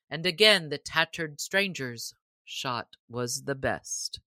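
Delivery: ordinary delivery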